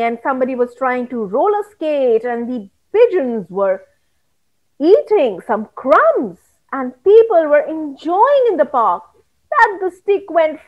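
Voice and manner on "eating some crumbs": usual speaking voice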